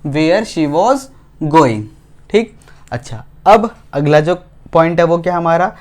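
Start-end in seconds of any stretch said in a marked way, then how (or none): 0.1-1.8 1 slow dictation. Shown as a demonstration. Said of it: "बात समझ में आ रहा है?".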